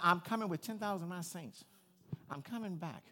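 ordinary delivery

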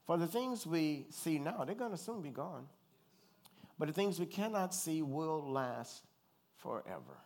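slow, weighty reading of the verse; measured pulpit reading; scripture reading. Acted. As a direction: read it out like holy writ. Reads scripture slowly.

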